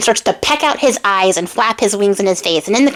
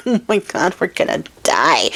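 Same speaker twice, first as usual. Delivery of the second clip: mock dread; 4 semitones lower, 3 dB quieter than usual. Charming sees bones, expects death.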